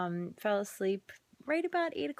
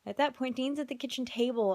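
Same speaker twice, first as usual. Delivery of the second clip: ordinary delivery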